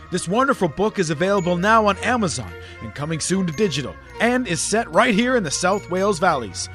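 smooth promo voiceover read. Acted. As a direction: read it like a book advert. Ad read over music.

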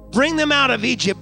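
ordinary delivery

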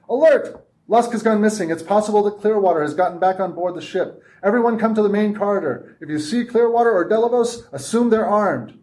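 booming announcement. A narrator's reading. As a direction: boom it over the speakers. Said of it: "A voice boomed from every speaker".

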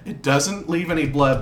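ordinary talk

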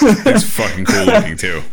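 high, laughing through the words. Real, not acted. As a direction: giggle out the compliment. Laughing while praising monster.

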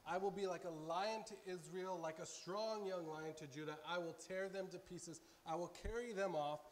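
loud, fervent reading of God's words; impassioned scripture reading. Acted. Preacher reading God's plea to Israel, loud and fervent. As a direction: proclaim it like the Lord pleading. Reads Hosea aloud with passion.

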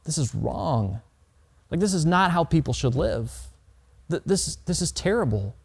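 quiet, choked-up murmur fighting back tears; holding back tears. Retold or imitated. Preacher recalls a Haitian family's home, softly holding back tears. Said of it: "fighting back tears".

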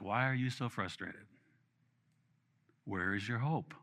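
soft, gentle questioning; lightly voiced God, asking softly. Pastor voicing God gently asking why he's frustrated.

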